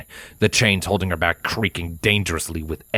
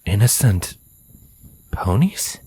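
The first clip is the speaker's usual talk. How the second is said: bewildered echo, rising at the end; hesitant, baffled question; taken aback. Acted. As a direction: repeat it haltingly in puzzled surprise. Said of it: "seemingly taken aback by her statement".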